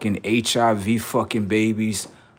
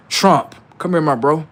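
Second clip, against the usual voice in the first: calling out; 5 semitones higher, 6 dB louder than usual. Acted. Calling out to Trump.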